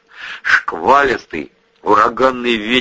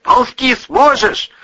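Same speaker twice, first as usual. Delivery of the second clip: slow, high, loud shouted question; shouting; 5 dB louder, slower than usual. A narrator's reading. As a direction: call it out over the surf. Brother shouting in the storm.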